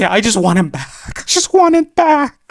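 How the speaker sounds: climbing to a high, loud scream; hammed-up desperate cry, building; anguished wail. Fan begging for Kaneki's return, anguished building wail. Fan wailing for his favourite character.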